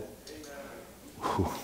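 ordinary talk, nothing marked

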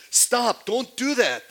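high, loud cry to stop; a sharp high protest against being worshipped; alarmed shout. Acted. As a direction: shout them down, horrified. Human being refusing worship.

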